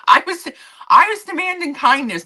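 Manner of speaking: high, loud, amused exclamation; laughing recollection. Real, not acted. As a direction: crack up recalling your own outburst. Laughing at her own meltdown.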